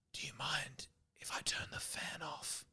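soft unvoiced whisper; whispered request. Host leans over guest's bed at night, whispering. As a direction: whisper it like someone's asleep.

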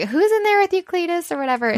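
high-pitched nosy call; mock calling out. Jessica interrupting the couple with a flashlight, high mock call.

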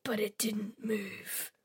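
hushed, slow suspense whisper. A narrator's reading. Suspense in narration.

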